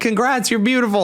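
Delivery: high, gushing imitation of fans; mock congratulations. Mimicking fans after singer's weight loss, mock congratulations. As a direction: congratulate her in a put-on high voice.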